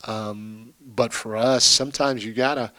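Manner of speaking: ordinary talk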